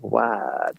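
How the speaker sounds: low creaky daughter impression; mock fry, pitched low; imitated vocal fry. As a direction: mimic a daughter creaking out her reply. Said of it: "Stop with the vocal fry".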